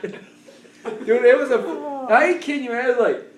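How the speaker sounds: ordinary talk, nothing marked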